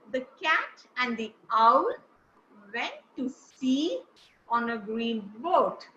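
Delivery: slow, pause before each content word; stressed content words. Shown as a demonstration. Teacher modelling the sentence with stressed content words. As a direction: lean on cat, sea and boat. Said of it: "let's try the sentence once again".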